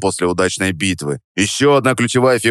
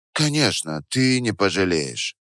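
soft seductive promise; 6 dB quieter than usual. Acted. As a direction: promise it softly. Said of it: "пообещала Вера".

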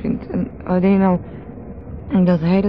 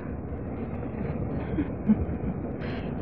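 laugh; 7 semitones higher, 10 dB quieter than usual. Acted. Scornful aside after insult.

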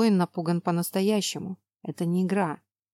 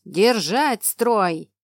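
slow, loud barked command; barked order; 4 dB louder, much slower than usual. Acted. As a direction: bark it out once more, firmly. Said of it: "рявкнул". (рявкающий приказ)

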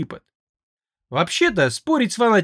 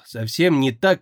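ordinary delivery, nothing marked

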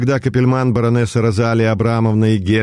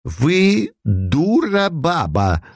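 slow, higher, spat-out insult; angry retort; 7 semitones higher, much slower than usual. A narrator's reading. Капельман rounds on the baroness, angry and slow. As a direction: drag out the insult in anger. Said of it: "гневно ответил Капельман".

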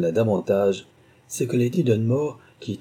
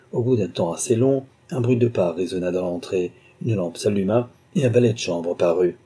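usual speaking voice

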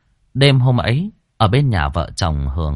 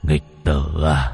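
ghostly mockery; 6 semitones lower, much slower than usual. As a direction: drag out the words like a mocking ghost. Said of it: "Nó ngoác ra nụ cười ma quái". (giọng ma trầm, chậm và rất nhỏ, giễu cợt)

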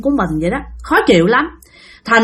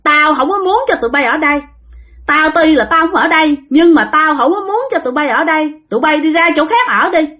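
put-on higher, contemptuous old man; old spirit telling the family to leave, higher voice; gruff old ghost; 6 semitones higher than usual. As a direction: order them off his land. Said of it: "Cái ông cụ nói rằng".

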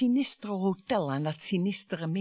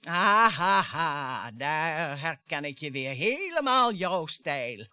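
mocking laugh; 5 semitones lower, much slower than usual. Wife mocks husband's dismissal.

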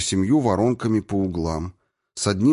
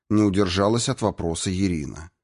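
usual speaking voice

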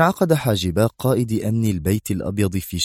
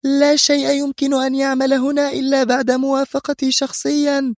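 very high-pitched, icy authority; cold cruel leader. A narrator's reading. Leader answers her subordinate with terrible coldness. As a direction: assert absolute control in a high voice. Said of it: "في برود قاس رهيب".

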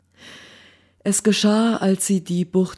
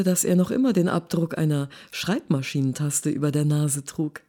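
usual speaking voice

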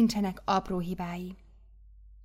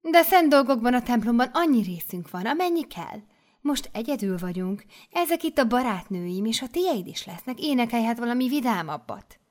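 higher, louder coaxing abbess voice; mother superior; 4 semitones higher, 4 dB louder than usual. Acted. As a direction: persuade her kindly but firmly.

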